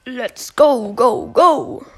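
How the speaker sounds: loud, commanding rally cry; rally the troops as the dog colonel; Colonel Ruffs voice line. Retold or imitated. Imitating Colonel Ruffs for viewers, loud army shout. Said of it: "Jetzt Con Ruffs".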